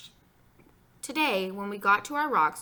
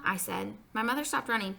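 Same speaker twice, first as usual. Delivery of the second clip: ordinary delivery, nothing marked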